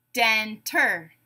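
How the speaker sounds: "Denter" split in two, den-ter; syllable by syllable. Shown as a demonstration. Teacher giving a word to blend, said syllable by syllable. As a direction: leave a gap between the beats of "Denter". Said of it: "say the syllables in a word".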